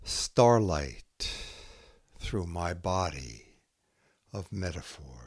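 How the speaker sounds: slow, measured haiku reading with long pauses between poems; poetry reading. Acted. Poet reading her own haiku to listeners, slow and measured. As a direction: let each image land before the next.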